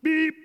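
short high-pitched beep; squeaky little horn beep; truck beep. A narrator's reading. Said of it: "said Blue".

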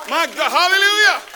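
high, loud hallelujah; praise shout. Real, not acted. Preacher praising God before the congregation, high loud shout.

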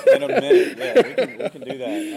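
high, loud, laughing echo. Real, not acted. Teacher laughing at covering the Trinity in a minute, high and loud. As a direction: laugh the words out.

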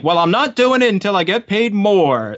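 a lightly voiced stubborn stuntman haggling; stubborn stuntman demand. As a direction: drag it out like a cocky stuntman refusing to budge. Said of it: "And he's like".

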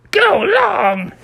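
very high creature squeak; tiny high-pitched squeal; squealing Grogu. Acted. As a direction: squeak out the command like a tiny alien. Grogu voiced in the same squeal.

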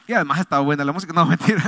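fast, laughing aside; laughing joke. Real, not acted. Pastor joking to the congregation about the song, laughing fast. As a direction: toss off the joke through a laugh.

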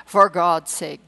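loud, slowed desperate plea; urgent plea. A narrator's reading. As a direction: beg for the water. Wounded man begging for water.

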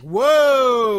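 long, loud, high whoa; stunned whoa. Retold or imitated. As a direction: draw out a booming cry of astonishment. Reenacting own stunned reaction.

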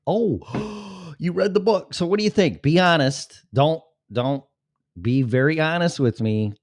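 high, gasping eager questions; excited eagerness. Real, not acted. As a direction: gasp and lean in, eager to hear her opinion. Eager to hear about book.

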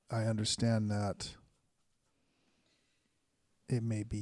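slow, formal address to the dead; measured, ceremonial questioning with long pauses; solemn cleric in need of answers. Acted.

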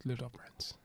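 soft breathy whisper to sound sexy. Pretend. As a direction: hush it like a sexy secret. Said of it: "Why are you whispering it".

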